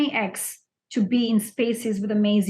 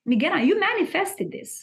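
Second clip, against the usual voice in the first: higher, knowing declaration; knowing wife; 8 semitones higher than usual. Retold or imitated. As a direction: voice the wife, pitched up and sure. Wife's line, voiced in story.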